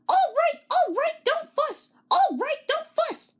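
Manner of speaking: very high, squeaky mock grumble; silly trucks grumbling at Thomas. A narrator's reading. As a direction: whine it like grumpy toy trucks. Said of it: "grumbled the trucks".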